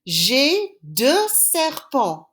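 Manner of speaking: "J'ai deux serpents" spelled out slowly, stress on "deux"; slow demo sentence. Shown as a demonstration. Teacher sounds out a French sentence for the class, slowly. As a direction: lay it out word by word, leaning on "deux". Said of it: "If I said to you".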